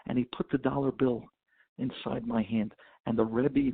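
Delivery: normal talking voice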